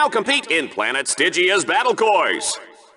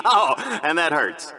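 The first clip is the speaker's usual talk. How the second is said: laughing; 4 semitones lower than usual. Acted. Laughs at contestant's pain.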